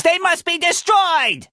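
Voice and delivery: high, dramatic outcry; urgent battle order. Acted. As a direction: command their destruction with a soaring voice. Urgent order against attacking robots.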